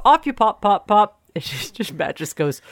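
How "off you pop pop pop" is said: higher, louder show catchphrase; mimicked send-off, pitched up; catchphrase imitation. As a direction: call it out brightly in imitation.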